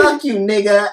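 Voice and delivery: high, loud mock insult; arguing dude, hammed-up. Host resumes acting two dudes fighting, mock angry. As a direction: throw the pretend insult out loud. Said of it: "Two dudes".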